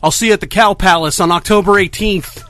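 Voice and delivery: loud, fast challenge to a rival; wrestling promo. Acted. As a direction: hype it up like a wrestler calling out. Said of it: "I just had to cut a promo".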